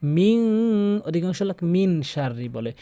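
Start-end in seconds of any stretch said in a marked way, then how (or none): 0.0-1.0 মিন with ikhfa, hidden nasal; nasalized min. Shown as a demonstration. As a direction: take the n of মিন into the nose. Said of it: "নাকের ভিতর নিতে হবে".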